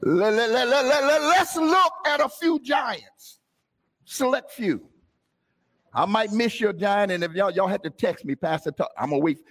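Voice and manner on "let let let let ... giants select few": stammered start rising to a high, slow shout; building preacher's call. Acted. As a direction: wind up and launch the sermon point.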